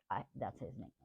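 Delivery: normal talking voice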